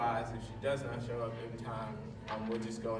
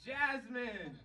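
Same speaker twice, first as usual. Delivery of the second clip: high, loud, stretched announcing; announcer hype; 4 dB louder, slower than usual. Real, not acted. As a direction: hype up the crowd as MC. Announcing the final round.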